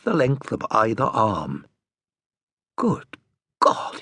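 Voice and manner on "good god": breathy awed whisper of surprise; hushed whisper. Acted. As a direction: gasp it out under your breath. Stunned discovery muttered to himself.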